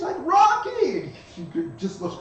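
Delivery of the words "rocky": put-on high, loud squeal of a little girl. Seven-year-old greeting Rocco as she runs up, little-girl squeal. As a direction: cry the name out in delighted child's pitch. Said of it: "Seven-year-old in this beautiful pink".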